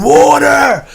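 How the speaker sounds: loud, high cry for water; sudden frantic shout; retold scream. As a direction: yell it like he's parched and panicking. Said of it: "he's, like, screaming".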